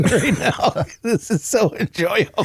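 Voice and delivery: talking through held-back laughter; trying not to laugh while speaking; stifled giggles. Real, not acted.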